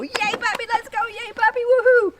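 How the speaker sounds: very high, rising to a loud woohoo; cheering puppy call. Shown as a demonstration. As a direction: celebrate the puppy coming to you. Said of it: "you can go".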